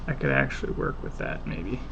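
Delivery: whispered to self; whispering. Real, not acted. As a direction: whisper the thought to yourself. Muttering to self while working.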